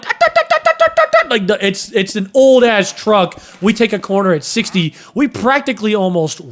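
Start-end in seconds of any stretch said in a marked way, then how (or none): 0.0-1.3 high, sputtering "dut dut" engine noise; mock engine sputter. Friends in the truck flooring it, imitating the high sputtering engine. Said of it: "and we're going like".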